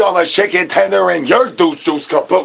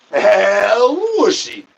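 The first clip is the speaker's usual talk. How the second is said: slow, stretched El Whooshy call; drawn-out catchphrase; 6 semitones higher, much slower than usual. Pretend. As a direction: stretch it out like a showman. Catchphrase variant.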